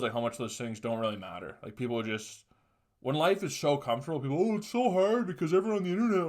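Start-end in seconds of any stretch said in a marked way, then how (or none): 4.4-6.3 mocking whiner. Mocking whiny complainers.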